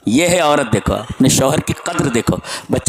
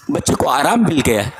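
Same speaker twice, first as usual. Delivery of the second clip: ordinary talk, nothing marked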